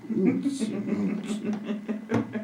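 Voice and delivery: high, very slow sing-song; sing-song high drawn-out. Shown as a demonstration. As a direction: stretch it out high and playful.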